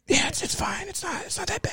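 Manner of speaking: soft mock-reassuring whisper; hushed, conspiratorial murmur. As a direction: whisper it as if nobody can hear. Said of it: "if you whisper it".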